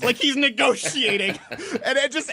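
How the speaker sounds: high, loud words through laughter; real laughing. Real, not acted. Host amused by his own voicemail impression, laughing. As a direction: crack up while explaining the bit.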